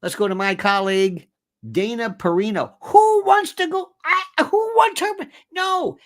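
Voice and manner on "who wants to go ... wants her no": much higher, louder disbelief; exasperated. Real, not acted. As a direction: wave the choice away in exasperation. Mocking the network's panel.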